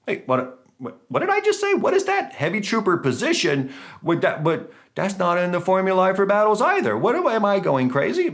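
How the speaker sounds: high-pitched, hammed-up disbelief; mock bewilderment. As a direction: act like you're doubting your own sanity. Acts baffled at his own discovery.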